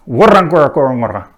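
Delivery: "warangora korongora" fast and loud, heaviest on the first syllable; main and secondary stress. Shown as a demonstration. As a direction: hit the first syllable of "warangora korongora", lighter beats on every other one after. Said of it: "main stress on the first, and then secondary stress".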